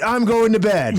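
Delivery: high, loud defensive whine; caught boyfriend voice. Retold or imitated. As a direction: squeal out a guilty excuse. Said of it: "It's that guy going".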